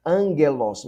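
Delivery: "Angelos" repeated with a nasal "ng" and a firm stress; gamma as N. Shown as a demonstration. Teacher repeating "Angelos" for students, gamma as N. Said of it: "se lê como se fosse um N".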